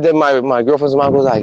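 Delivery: normal talking voice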